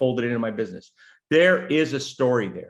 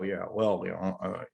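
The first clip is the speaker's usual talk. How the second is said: trailing off, quieter, barely trying; mumbled dull interviewee; 5 semitones lower, 7 dB quieter than usual. Retold or imitated. Mocking a dull guest who mumbles quietly. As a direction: sound like a guest with nothing to say.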